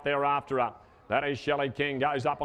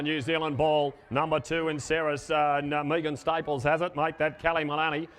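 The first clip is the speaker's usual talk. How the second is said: ordinary talk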